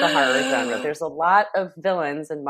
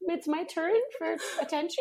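dog's voice; 12 semitones higher, 8 dB quieter than usual. Pretend. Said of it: "She was just like".

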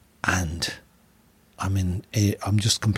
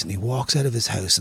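ordinary talk

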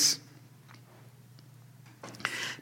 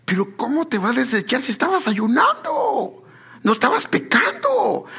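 voicing the pastor; 10 semitones higher than usual. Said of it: "Y me dice él".

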